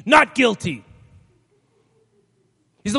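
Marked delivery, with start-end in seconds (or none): 0.1-0.9 defiant plea. Retold or imitated. Defiant defendant in court.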